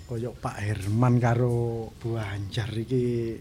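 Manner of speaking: ordinary talk, nothing marked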